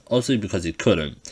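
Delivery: ordinary delivery, nothing marked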